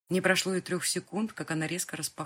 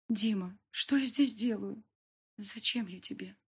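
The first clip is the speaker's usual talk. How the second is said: trembling voice; 6 semitones higher than usual. Acted. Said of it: "Мой голос дрожал".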